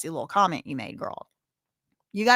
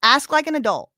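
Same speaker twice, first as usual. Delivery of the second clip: annoyed snap; 5 semitones higher, 5 dB louder than usual. Real, not acted. Scolding sassy commenters.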